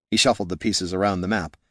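plain reading voice

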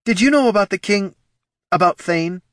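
high, loud demand; pressing, high-pitched interrogation; urgent interjection. Acted. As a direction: cut in with an urgent question. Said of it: "Katha interjected".

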